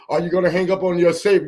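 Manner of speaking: usual speaking voice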